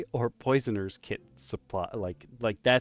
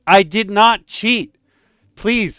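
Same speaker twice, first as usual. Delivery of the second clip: higher, loud, drawn-out schoolkid denial; indignant protest; 7 semitones higher, 11 dB louder, slower than usual. Retold or imitated. Child denying cheating to the teacher, indignant. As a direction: protest your innocence, loudly re-enacted. Retold classroom protest at accusation.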